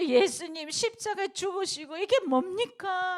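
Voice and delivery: high, slow wailing lament; acted wailing. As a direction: cry out in grief as Peter runs. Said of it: "막 울고불고 땅을 치고". (도망가는 베드로가 울부짖듯 높고 느리게)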